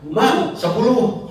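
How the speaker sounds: high, drawn-out call to a street vendor; imitated call from a car. Woman in a car ordering from the snack seller, mock calling out. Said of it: "Teriak dari mobilnya kan".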